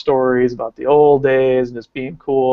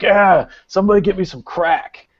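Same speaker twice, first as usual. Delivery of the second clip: mock yell, pitched higher; higher-pitched retold demand; 6 semitones higher than usual. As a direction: holler out a demand, voice raised in pitch only. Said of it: "he's yelling at the promoter".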